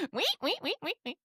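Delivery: high squeaky guinea pig noises; high-pitched wee wee squeaks. Shown as a demonstration. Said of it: "my best guinea pig impression".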